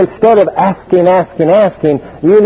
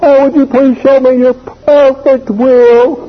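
high, slow, holier-than-thou prayer voice; sanctimonious son stalling, high and slow; mock pious plea; 8 semitones higher, slower than usual. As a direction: pretend piety to dodge chores.

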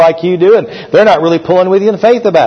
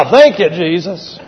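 laughing thanks; 5 semitones higher than usual. Real, not acted. Laughing with the congregation.

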